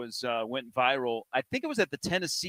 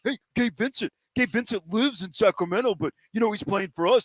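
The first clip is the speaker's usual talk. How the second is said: higher, cocky fan voice; mock Laker fan; 6 semitones higher than usual. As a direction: brag like a smug Lakers fan. Mocking Laker fans on air.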